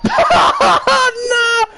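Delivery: loud high laugh into a shouted no; high laughing cry of no. Real, not acted. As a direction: laugh out a protest. Laughing protest at friend.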